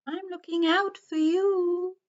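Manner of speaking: high, hooting sing-song owl. A narrator's reading. Wise owl warns the forest creatures, high hooting voice. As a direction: say it as a long high hoot. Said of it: "He blinked his big round eyes and said".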